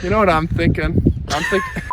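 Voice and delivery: high voice with giggles; giggly high-pitched pleading. Pretend. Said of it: "Why is your voice so high?".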